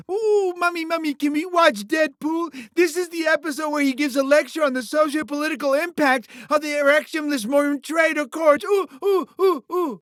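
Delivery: put-on high-pitched excited kid begging mommy; mock eager child fan. Boss mocks imaginary fans of a thinking Deadpool, mock child voice. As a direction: squeal it like a hammed-up little fan. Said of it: "No one tunes in to see Deadpool think".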